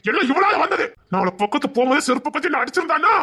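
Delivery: high, loud and bitter; high furious yell of blame; furious blaming shout. Acted. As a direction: spit the blame at her. Blaming her in rage.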